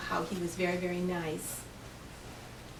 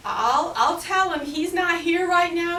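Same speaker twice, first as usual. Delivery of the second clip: high, loud, nervous voice; scared lie; 7 semitones higher, 7 dB louder than usual. Acted. Finn hiding who he is from giant, scared high voice. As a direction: fake it while terrified.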